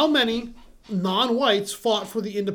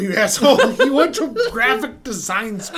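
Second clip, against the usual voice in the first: laughing tease; 5 dB louder than usual. Real, not acted. Teasing friend about art school.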